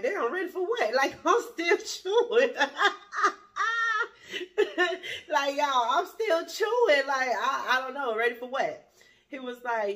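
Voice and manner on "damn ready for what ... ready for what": high and loud, laughing through the words; cracking up at how absurd it is; real laughter, still chewing; laughing disbelief. Real, not acted. Laughing at date's sudden demand.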